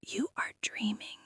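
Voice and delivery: soft unvoiced whisper. Shown as a demonstration. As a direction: breathe it as a gentle whisper. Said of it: "a very soft whisper may gently remind".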